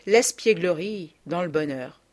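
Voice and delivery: ordinary delivery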